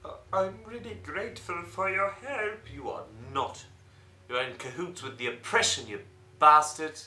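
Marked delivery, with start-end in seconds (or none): 4.3-7.0 loud furious insult; angry shout. Acted. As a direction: yell it in his face. Confronting the traitor.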